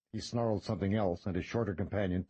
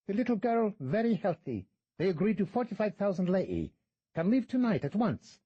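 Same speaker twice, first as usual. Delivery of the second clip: put-on higher, foreign-accented guide voice; a smirking fixer naming his price; shady tour guide; 13 semitones higher, 4 dB louder than usual. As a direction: play the sly, haggling guide. Guide's voice, no speech tag.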